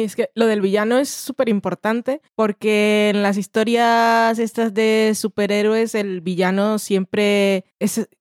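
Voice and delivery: usual speaking voice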